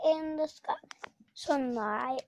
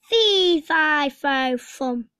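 booming giant; 7 dB louder, slower than usual. Acted. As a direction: boom it out like a giant. Giant's famous chant.

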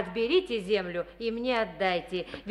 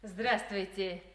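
ordinary talk

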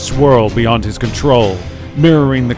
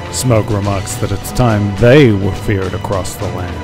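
ordinary delivery, nothing marked

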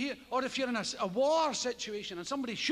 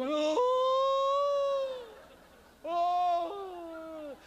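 cold agony moan; 6 semitones higher than usual. Retold or imitated. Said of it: "lost the will to live".

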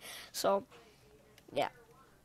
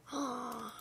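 soft sharp intake of breath; surprised gasp; 11 dB quieter than usual. Real, not acted. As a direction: gasp at a sudden reward. Surprised by the drop.

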